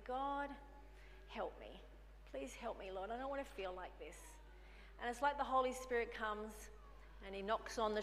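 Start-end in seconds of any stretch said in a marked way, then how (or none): 0.0-4.2 soft pleading prayer. Retold or imitated. Retold prayer in distress.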